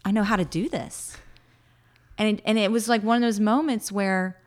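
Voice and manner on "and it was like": normal talking voice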